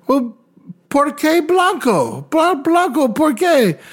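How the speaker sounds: high, slow pidgin Spanish question; mock broken Spanish. Tourist asks the dealer in mock Spanish, high and puzzled. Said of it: "And I was like".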